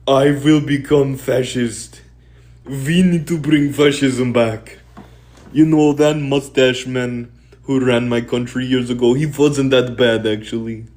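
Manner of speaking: put-on German accent, drawn out; bad German accent. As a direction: play a disgruntled German, badly. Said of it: "That was my German accent".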